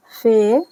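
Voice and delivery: फे said clearly, with an f sound; फे, an Urdu letter name with an f sound. Shown as a demonstration. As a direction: say फे with a crisp f. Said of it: "इस तरह पढ़ेंगे फे".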